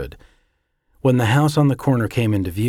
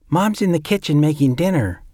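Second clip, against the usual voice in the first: put-on high, bright child's voice; little boy Jeffrey; 7 semitones higher, 3 dB louder than usual. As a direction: chirp it like a small boy greeting his uncle. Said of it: "Jeffrey said".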